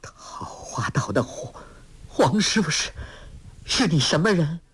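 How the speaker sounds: faltering half-whisper, then shaky; a villain fearfully asking about her old master; trembling question. A narrator's reading. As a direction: ask it trembling with dread. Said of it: "颤声问道".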